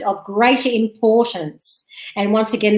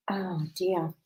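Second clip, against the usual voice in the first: whispered aside; 4 semitones lower, 13 dB quieter than usual. Real, not acted. Aside mid reading.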